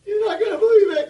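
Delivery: very high, loud laughing shout; laughing kid. Retold or imitated. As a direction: blurt it out giggling like a kid. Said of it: "I'm like".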